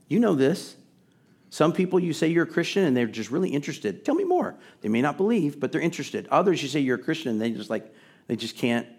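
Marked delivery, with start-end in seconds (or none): 4.0-4.6 eager listener. Retold or imitated. Eager listener voiced in sermon.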